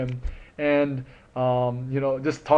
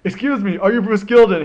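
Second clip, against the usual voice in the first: higher and louder, eager to meet him; an excited fan approaching his idol; eager fan question; 7 semitones higher, 8 dB louder than usual. Retold or imitated. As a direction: call out to your hero. Approaching his hero nervously.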